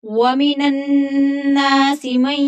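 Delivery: slow, drawn-out melodic tajweed chant; Quran recitation. Acted. Teacher reciting the verse to students before explaining it, slow melodic chant. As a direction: recite slowly, stretching each long vowel.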